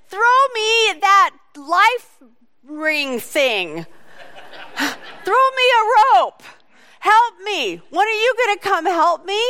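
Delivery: hammed-up drowning man begging for a rope; mock panicked yell. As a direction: Peter yelling to his brother disciples, mock panic. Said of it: "He could have yelled".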